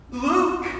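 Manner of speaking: excited high call of a name; joyful cry. Retold or imitated. Father recognizing his lost son, crying out joyfully.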